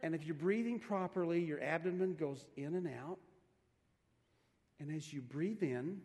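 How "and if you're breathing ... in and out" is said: normal talking voice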